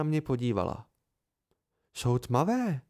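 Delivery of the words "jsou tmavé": ordinary delivery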